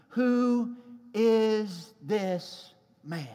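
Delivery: drawn-out, weighty refrain, slowly; slow dramatic question. Acted. Preacher to congregation, slow weighty question. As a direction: let the question hang slowly.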